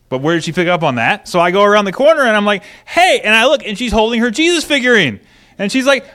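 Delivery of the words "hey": put-on high, loud "hey" to a child; parent calling out. Parent rounds the corner to daughter, loud high call. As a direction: call out sharply in reproof.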